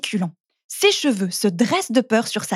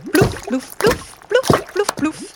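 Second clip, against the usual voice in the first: splash sounds; 9 semitones higher, slower than usual. A narrator's reading. Butter splashing into pot.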